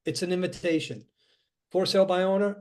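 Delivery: ordinary talk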